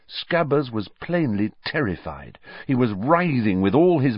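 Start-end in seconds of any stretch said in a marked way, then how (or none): none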